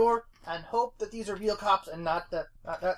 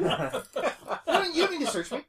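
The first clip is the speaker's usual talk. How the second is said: higher, laughing nervous plea; 9 semitones higher than usual. Retold or imitated. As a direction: plead not to be searched. Player voicing Scooter.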